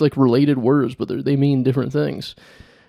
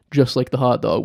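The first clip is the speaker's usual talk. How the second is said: normal talking voice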